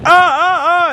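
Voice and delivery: high, loud hammed-up pained ahs; mock the groans of a man faking pain; fake pained ahs. [name] mock pain in door.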